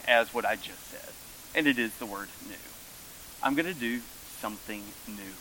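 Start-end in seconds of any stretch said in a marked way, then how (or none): 3.4-5.3 quiet Jesus quote. Retold or imitated. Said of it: "Jesus said".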